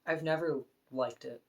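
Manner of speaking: ordinary delivery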